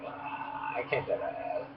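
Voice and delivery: breathy, hushed mutter under the breath; softly muttered complaint; frustrated whisper. Retold or imitated. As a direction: whisper it in defeat. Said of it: "I was like".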